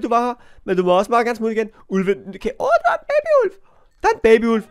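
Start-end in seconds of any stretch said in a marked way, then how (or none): 2.6-4.6 high laughing coo at something cute; cooing squeal. Real, not acted. Sees a baby wolf. (højt kælent hvin)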